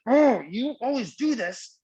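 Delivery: high, drawn-out mock exasperation; frustrated outburst. Acting out snapping back at someone frustrating, frustrated outburst. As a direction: groan the oh, then complain high and slow. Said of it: "she makes me frustrated and I'm like".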